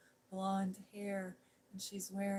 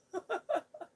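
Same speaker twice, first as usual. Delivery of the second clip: quick, high burst of laughter; short laugh; 13 semitones higher, 6 dB louder than usual. Real, not acted. Picturing Sean Connery on screen, a bright laugh. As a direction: laugh at the memory.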